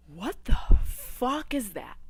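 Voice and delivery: slow, loud, drawn-out disbelief; shocked outburst. Retold or imitated. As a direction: spell out the horror word by word. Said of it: "I would be like".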